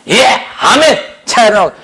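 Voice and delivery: high, ready acclamation; mock eager assent. Congregant supposedly answering Paul's command, mock eager. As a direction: jump at the order, hammed-up.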